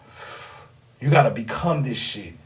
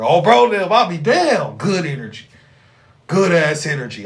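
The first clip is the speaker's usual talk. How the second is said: imitating bro; 2 semitones higher, 5 dB louder than usual. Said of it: "I'm about to be bro real quick".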